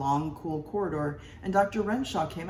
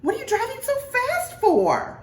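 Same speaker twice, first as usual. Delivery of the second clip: much higher, nagging wife voice; scolding wife; 7 dB louder than usual. A narrator's reading. Wife scolding the driving husband.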